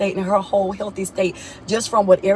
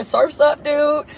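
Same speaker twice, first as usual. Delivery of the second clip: surfer dude; 7 semitones higher, much slower than usual. Retold or imitated. Mocking surfer boy catchphrase.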